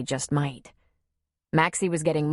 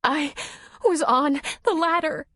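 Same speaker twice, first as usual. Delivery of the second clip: much higher, trembling words; shaking voice; 9 semitones higher than usual. Acted. Shaken woman tells him what happened, trembling. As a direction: speak still shaken with fear. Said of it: "her voice shaking".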